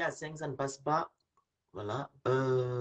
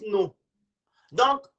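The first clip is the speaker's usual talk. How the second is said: normal talking voice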